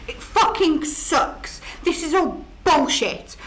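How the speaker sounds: usual speaking voice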